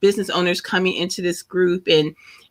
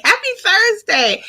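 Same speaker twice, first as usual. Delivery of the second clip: bright, much higher cheerful hello to viewers; excited greeting; 13 semitones higher than usual. Real, not acted. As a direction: sing out the greeting with joy. Greeting viewers on live stream.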